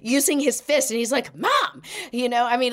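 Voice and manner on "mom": high, emphatic mock call. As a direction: imitate a kid insisting on mom's attention. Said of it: "he's really emphatic".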